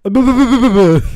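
loud high goat bleat imitation; mock bleating. Mocking sound from video.

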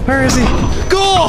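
high panicked shouting; scared shout. Real, not acted. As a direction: yell in terror. Bigfoot attacks camp.

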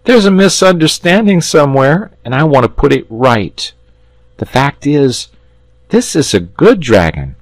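put-on high, urgent boy; a lad putting it right; earnest boy. Boy voiced in story.

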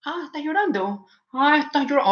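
high-pitched mocking parent; imitating a mother's ominous mock sympathy; mock-threatening taunt. Mother taunts a crying child.

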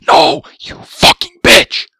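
loud raging yell at the game; furious shout. Real, not acted. Gamer dying at Zulrah, furious shout. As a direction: scream it out in real rage.

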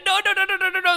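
high replay of his own frantic no's; imitating his panic. Gamer retelling his tense moment to viewers, mock panic. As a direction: show viewers how scared he was. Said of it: "Estaba ahí en plan".

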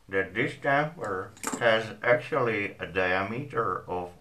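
talking around pipe stem; pipe in mouth. Real, not acted. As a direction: lips clamped on pipe. Seen 0: Pipe clenched in teeth throughout; placed just before, removed after.

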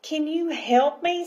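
lightly voiced tiny creature, pitched high; a hint of a small, high pleading voice; little voice. Tiny mermini asking the girl for help, little voice. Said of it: "said the little voice".

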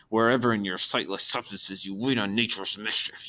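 hissed S's through clenched teeth. Shown as a demonstration. Teacher picturing Lady Macbeth's fell purpose, through clenched teeth. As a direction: bite off the S sounds, jaw tight, full of hatred. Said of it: "say that with your teeth clenched".

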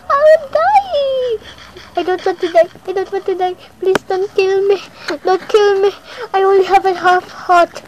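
high, loud desperate pleading; screaming at a spider. Real, not acted. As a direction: beg for your life in panic. Near death from spider.

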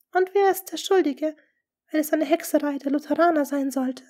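plain reading voice

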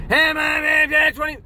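high, loud droning chant; auctioneer. Retold or imitated. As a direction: imitate a burst of auctioneer patter on one note. Tape of auctioneer replayed.

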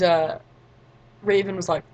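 normal talking voice

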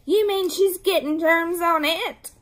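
put-on high, loud childish whine; upset squabbling child; loud protest. A narrator's reading. Children squabble over germy lollipop.

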